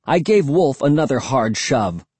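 usual speaking voice

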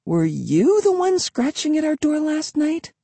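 very high, cooing question to a pet; high-pitched doggy talk. A narrator's reading. Kid talking to the dog.

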